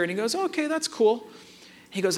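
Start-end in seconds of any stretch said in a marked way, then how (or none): 0.3-1.3 put-on high, soft-edged kid reply; lightly voiced son. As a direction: imitate a boy letting it go. Voicing his son backing off.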